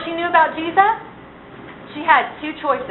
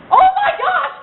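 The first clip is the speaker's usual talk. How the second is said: panicked shriek, much higher and louder, with a laugh; freaking out; 5 dB louder than usual. Pretend. [name] playing Eve after eating the fruit, shrieking in panic. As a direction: shriek it as if caught naked in Eden. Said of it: "I would be freaking out".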